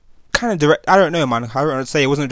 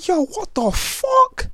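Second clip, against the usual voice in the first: much higher, slow, drawn-out shock; stunned disbelief; much slower than usual. Retold or imitated. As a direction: stretch it out in utter disbelief. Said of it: "I'm thinking".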